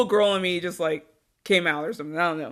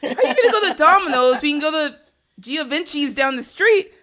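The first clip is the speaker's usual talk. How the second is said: high, loud incredulous protest; scandalised and laughing, pitch shooting up; playful outrage; 7 semitones higher, 5 dB louder than usual. Real, not acted. Playful outrage at choosing Domino's.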